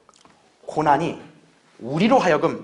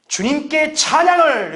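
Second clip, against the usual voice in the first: imitated hearty cry, pitched high and drawn out; vigorous cry of praise; 8 semitones higher, 4 dB louder, slower than usual. Bedridden sister answering her visiting pastor, imitated vigorous cry. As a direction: call it out with vigour, stretching the last word. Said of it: "이렇게 힘차게 외쳤다 그래요".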